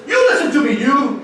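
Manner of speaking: loud, high-pitched telling-off; scolding Peter. Retold or imitated. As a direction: play an indignant Peter rebuking his master. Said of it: "Peter finds that is appropriate is he pulls".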